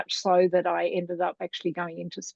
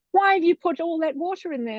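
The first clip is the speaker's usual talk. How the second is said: high-pitched scandalised teacher; mock-horrified kindy teacher demanding an answer, higher; 9 semitones higher, 3 dB louder than usual. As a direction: pitch it up like a shocked teacher scolding. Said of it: "She said".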